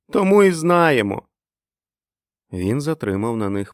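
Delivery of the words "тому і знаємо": put-on high, loud childish cry; high-pitched kid shout; children's chorus exclamation. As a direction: call it out like kids in unison. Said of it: "вигукнули вони хором".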